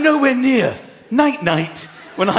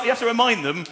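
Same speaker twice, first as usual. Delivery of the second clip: ordinary talk, nothing marked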